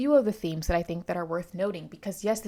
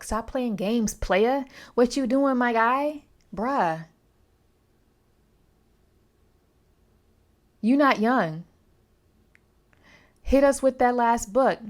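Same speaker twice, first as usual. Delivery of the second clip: louder, higher, teasing scold to the author; playful louder scolding of a slow writer; sassy call-out; 5 semitones higher, 8 dB louder, slower than usual. Acted. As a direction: hurry him up like a friend who's late. Calling out the author directly.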